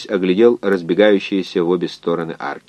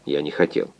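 soft, lower apology; quiet, abashed murmur; embarrassed mumble; 4 semitones lower, 8 dB quieter than usual. A narrator's reading. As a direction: apologise sheepishly under your breath. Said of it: "смутившись, пробормотал".

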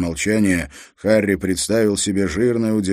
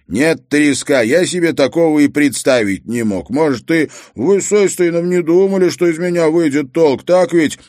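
loud, raised, urgent reply; exasperated retort; 8 semitones higher, 6 dB louder than usual. A narrator's reading. Harry answering Treska's taunt under fire, exasperated. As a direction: throw the taunt back at him firmly.

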